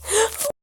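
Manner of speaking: high startled gasp; sharp gasp. Acted. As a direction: catch the breath in shock. Shocked gasp.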